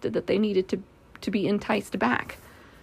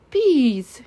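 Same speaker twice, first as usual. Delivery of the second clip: louder call of discovery; excited cry; 4 semitones higher, 6 dB louder than usual. Acted. Pooh spotting the bees in the bush, crying out. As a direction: cry out at the sight, falling at the end. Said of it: "he cried".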